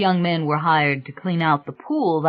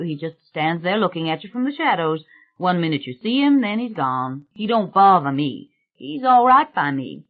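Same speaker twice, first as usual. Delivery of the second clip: untroubled gardener; 2 semitones higher than usual. Acted. Gardener describes the man in the shadows, unbothered. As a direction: voice an unbothered, matter-of-fact gardener.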